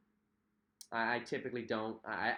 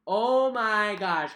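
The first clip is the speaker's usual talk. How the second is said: drawn-out exclamation; 7 semitones higher, 11 dB louder, much slower than usual. Real, not acted. Sore arms from workout.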